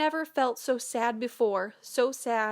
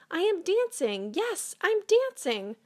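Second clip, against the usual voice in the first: rising higher and higher with joy; joyful cry; 10 semitones higher than usual. Acted. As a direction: build the delight to a high peak. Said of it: "Gerald cried".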